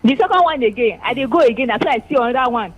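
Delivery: loud caller over a phone line. Real, not acted. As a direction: telephone voice, loud and distant.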